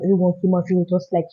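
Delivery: ordinary talk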